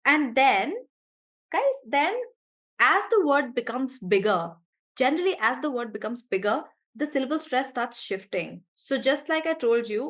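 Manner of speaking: ordinary delivery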